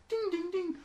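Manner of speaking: high sung ding, ding imitating metal; high ding sound. Host imitating a harp being sharpened, very high dings. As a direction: ring out each ding very high.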